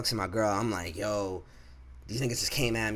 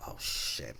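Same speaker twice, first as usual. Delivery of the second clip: whisper; 11 semitones lower, 8 dB quieter than usual. Retold or imitated. Boss calls him into office.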